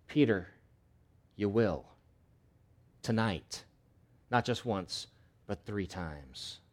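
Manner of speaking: soft, slowing to a near hush; quiet solemn warning. Acted. Jesus quietly telling Peter he will deny him. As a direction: tell him gently but gravely. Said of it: "Jesus looks at him and says".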